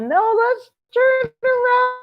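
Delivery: bright, urgent high voice; high excited quote. Retold or imitated. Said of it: "if Joyce hadn't said".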